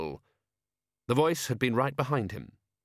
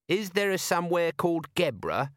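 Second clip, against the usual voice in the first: higher-pitched, mildly sulky question; 8 semitones higher than usual. A narrator's reading. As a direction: ask with a touch of a sulk. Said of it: "said Nobby sulkily".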